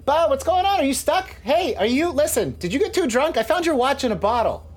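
high, insistent questioning; urgent calling. Acted. Vern checking on a partner behind the door, urgent high call. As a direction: call through a door, pitched right up.

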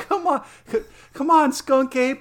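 squeaky pleading come on; high mock cheer. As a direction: egg him on in a high silly voice. Mocking fan cheering Skunk Ape.

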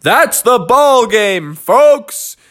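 slow, high announcer call; referee calling out. Acted. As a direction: call time to the whole stadium. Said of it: "the referee called out".

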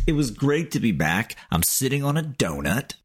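usual speaking voice

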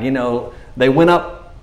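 ordinary talk, nothing marked

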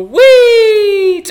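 gleeful whee. Acted. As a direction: launch into it with glee. Doing a backflip.

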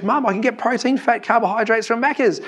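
put-on high, excited child's voice; excited kid. Johnny telling his mum about the voucher, high and excited. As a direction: tell mum like a thrilled little boy.

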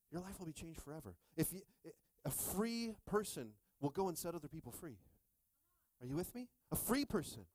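ordinary talk, nothing marked

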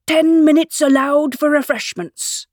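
slow, high-pitched announcement; calling out. A narrator's reading. King announcing a pause in the fight, calling out. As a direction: proclaim it across a crowd, drawn out. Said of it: "the king called out".

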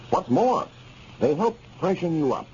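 ordinary delivery

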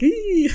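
high mock squeal of disgust; a squeamish "ee" squeal. Guys recoiling from the body.

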